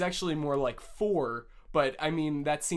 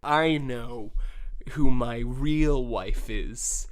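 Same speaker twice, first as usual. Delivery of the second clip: dramatic reveal; 4 semitones lower, 7 dB louder, much slower than usual. Acted. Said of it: "Charles de Gaulle said".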